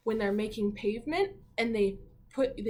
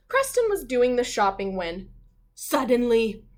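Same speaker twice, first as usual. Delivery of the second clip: storybook narration, building to suddenly; story reading; 7 dB louder than usual. A narrator's reading. Teacher reading the class a story, with a suspenseful pause. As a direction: read it like a picture book for kids.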